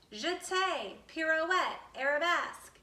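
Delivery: each step name lifted high with delight; proud, lilting step names. A narrator's reading. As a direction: sing out each step like a dancer showing off. Naming ballet steps proudly.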